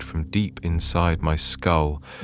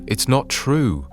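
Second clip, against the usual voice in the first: high, slow desperate denial; 7 semitones higher than usual. A narrator's reading. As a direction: plead against it. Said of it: "shaking my head desperately".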